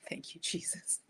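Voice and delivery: breathless whispered thank-you to Jesus; hushed grateful whisper. Retold or imitated. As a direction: whisper it like a relieved little prayer. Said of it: "and I was like".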